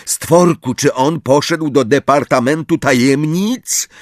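high, urgent questioning. A narrator's reading. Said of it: "ryknął Harry". (natarczywe pytanie podniesionym głosem)

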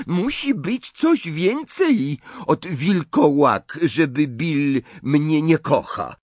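put-on high, slow, proud French-accented bride; a young French bride, wounded and defiant; Fleur, French accent. A narrator's reading. Fleur answers Mrs Weasley with a high French accent.

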